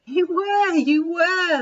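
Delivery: can't contain the glee; laughing gleefully. Acted. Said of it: "laughed Monty".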